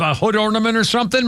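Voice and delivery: normal talking voice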